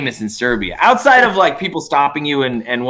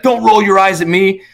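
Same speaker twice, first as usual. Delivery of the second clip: higher, louder mock aggressive retort; angry local guy; 7 semitones higher, 4 dB louder than usual. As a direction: imitate the guy snapping back at her. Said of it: "he's like".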